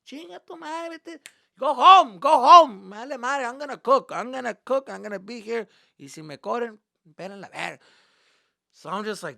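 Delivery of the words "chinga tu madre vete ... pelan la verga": loud, high-pitched angry rant; threatening dad. Retold or imitated. Dad telling his son to go home, angry and threatening. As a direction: bark defiant dismissals at your son. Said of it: "And he would threaten me".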